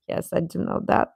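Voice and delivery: usual speaking voice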